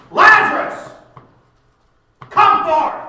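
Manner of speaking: huge, high commanding shout; loud cry. Acted. Jesus calls dead Lazarus out of the grave, in a very loud shout. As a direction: bellow it like an old-school Baptist preacher. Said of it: "I'm going to raise my voice".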